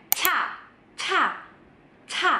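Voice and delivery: "ca" as high, breath-heavy "chha"; strongly aspirated C. Shown as a demonstration. Teacher demonstrating the aspirated letter, strong puff. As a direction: blast "ca" out with a big H. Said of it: "ada haknya banget cara bacanya".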